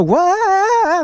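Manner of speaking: high yelp of being thrown forward; a sudden high "wah" as the bike takes off; high mock yelp of surprise; startled whoop. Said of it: "roll throttle and go".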